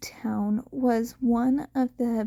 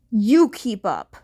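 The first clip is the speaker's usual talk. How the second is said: loud, punchy comeback; defiant retort; 7 dB louder than usual. A narrator's reading. Bakugo snaps back at Mirko while rocketing ahead, loud. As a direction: throw it back at her louder.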